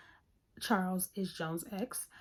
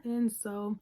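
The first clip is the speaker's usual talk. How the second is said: normal talking voice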